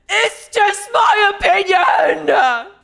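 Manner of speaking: high, slow yelling shout. Acted. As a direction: scream it out high and stretched.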